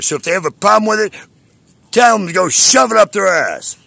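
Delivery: shouting in real anger; furious outburst. Real, not acted. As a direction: blow up at them. Furious rant about the police.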